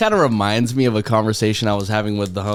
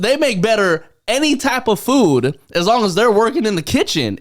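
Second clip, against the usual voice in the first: excited rant; 8 semitones higher than usual. Retold or imitated. Telling friends about restaurant kitchens, excited higher rant. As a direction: pitch it up and lean into each claim. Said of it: "And I'm like".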